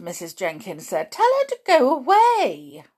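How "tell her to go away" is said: high, loud haughty dismissal; indignant demand. A narrator's reading. Mrs Jenkins wants grandmother gone, indignant. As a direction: play an outraged wife demanding her removal.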